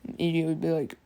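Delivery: usual speaking voice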